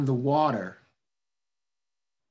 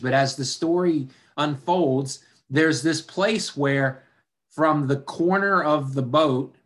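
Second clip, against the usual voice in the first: normal talking voice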